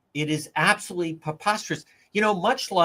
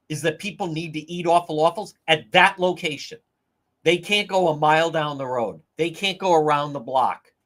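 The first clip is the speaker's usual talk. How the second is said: ordinary talk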